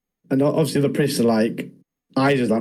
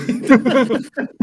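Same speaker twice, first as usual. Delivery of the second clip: high, bursting laugh; real helpless laugh; loud burst of laughter; 12 semitones higher, 4 dB louder than usual. Real, not acted. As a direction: crack up at the joke. Laughing at bloodline joke.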